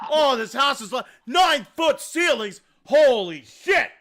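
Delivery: high, loud, overexcited gushing; guy loudly marvelling at oak doors; excited gushing. Retold or imitated. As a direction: rave like an overeager inspector. Inspector gushing over house.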